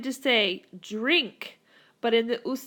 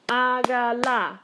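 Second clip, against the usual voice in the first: slow "hágala", every vowel drawn out; syllable by syllable; 4 dB louder than usual. Shown as a demonstration. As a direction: sound out "hágala" in three stretched beats. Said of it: "Here's my first vowel".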